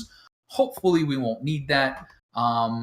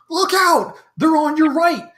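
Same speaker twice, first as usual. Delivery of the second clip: high, loud battle cry to an ally; urgent warning shout; 13 semitones higher, 8 dB louder than usual. Acted. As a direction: yell a warning mid-fight, much higher. GM voices player calling warning.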